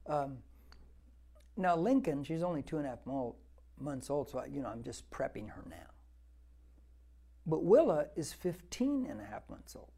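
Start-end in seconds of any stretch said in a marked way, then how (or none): none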